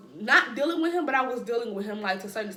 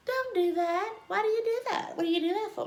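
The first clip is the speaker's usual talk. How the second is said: high mock girl; 10 semitones higher than usual. Voicing Cindy Lou's protest.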